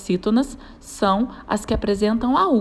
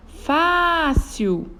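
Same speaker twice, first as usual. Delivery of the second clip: fácil with FA held long, higher; calling the word; 6 semitones higher than usual. Shown as a demonstration. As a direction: stretch the first syllable of Fácil. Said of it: "chamando a palavra". (chamando fácil, com o fá alongado)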